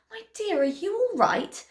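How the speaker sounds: lightly voiced, high fairy; concerned fairy. A narrator's reading.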